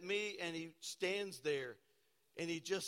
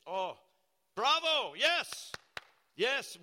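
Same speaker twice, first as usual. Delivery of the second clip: high, loud sarcastic bravo; mock cheer; 6 semitones higher, 8 dB louder, much slower than usual. Preacher mocking listeners who love money, sarcastic high cheer. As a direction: cheer it up like a fake fan.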